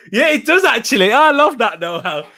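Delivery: high-pitched, loud delight; real burst of amused excitement; excited delight. Real, not acted. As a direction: react thrilled that the trick works. Delighted the accent trick works.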